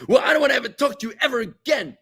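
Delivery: high-pitched acted tantrum shout. Exasperated relative ending a political argument, shouting. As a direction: blow up as if cutting someone off. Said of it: "they shout at you".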